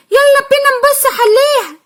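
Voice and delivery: put-on high, quick little-kid voices; child voices. Two little sisters panicking about forgotten school paints, childish voice. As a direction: play the worried small children.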